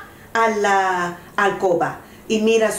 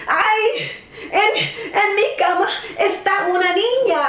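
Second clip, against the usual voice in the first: high, hurried bear exclamation; startled cry; 9 semitones higher than usual. A narrator's reading. Bear discovers a girl in the bed, high startled cry. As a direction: cry out high in mock alarm. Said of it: "el oso grande grita".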